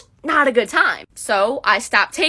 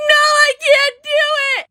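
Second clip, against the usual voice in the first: wailing cry; 4 dB louder than usual. Retold or imitated. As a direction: wail it like a panicked kid. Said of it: "I'm crying so loud that the doctor can hear me".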